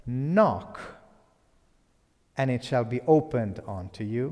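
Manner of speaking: loud, indignant pulpit repeat; acted offended preacher, loud and emphatic; really offended preacher. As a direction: boom it out, deeply affronted. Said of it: "the preacher is now really offended".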